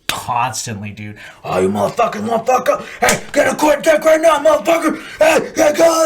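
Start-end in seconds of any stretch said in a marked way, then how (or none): 1.4-6.0 high, loud barked abuse; hammed-up high-pitched sergeant shout; drill instructor yelling. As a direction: imitate a drill instructor screaming orders. Imitating drill instructor yelling at recruits.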